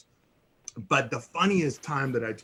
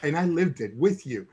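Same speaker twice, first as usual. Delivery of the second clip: ordinary talk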